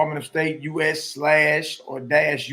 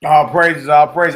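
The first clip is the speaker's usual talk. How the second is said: calling out praise to the audience, much louder; 12 dB louder, slower than usual. Real, not acted. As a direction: shout it like opening a service. Streamer opening, calling out praise.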